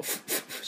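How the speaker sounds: unvoiced lip-teeth F puffs; F sound drill. Shown as a demonstration. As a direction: blow the F through the teeth. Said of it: "Fの音、しっかりね".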